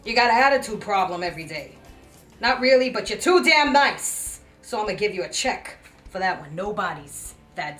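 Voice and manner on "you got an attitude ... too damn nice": loud dressing-down, rising in pitch; scolding. Acted. Boss scolding employee over attitude, loud. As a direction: raise the voice and stretch the last words.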